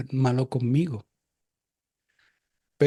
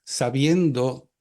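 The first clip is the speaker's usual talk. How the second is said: usual speaking voice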